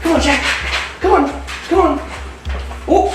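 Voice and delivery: high, eager coaxing call; excited calling. Real, not acted. Keeper calling the lace monitors out for food, high and eager. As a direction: coax the lizard out with a high voice.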